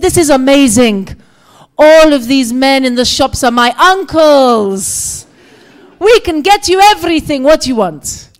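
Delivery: loud, high boasting welcome; booming, eager sales patter; exuberant young man. Retold or imitated. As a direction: imitate an overjoyed market helper, stretching the words.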